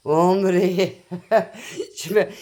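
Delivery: a short real laugh breaking into the words, slowed right down. Real, not acted. Laughing at herself as she tells viewers about her phones. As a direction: chuckle through it as the thought slips.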